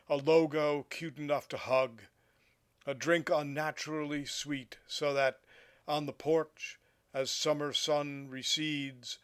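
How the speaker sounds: measured poetry reading, projected above chatting level; poem recitation. Acted. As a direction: read the poem out phrase by phrase, with pauses between images. Reads a poem aloud.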